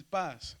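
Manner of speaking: "paz" con la A alargada que sube y baja; circunflejo exagerado. Shown as a demonstration. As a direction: exagera un poco el arco de la A en "paz". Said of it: "lo estamos exagerando un poco". ("paz" estirado, con tono que sube y cae)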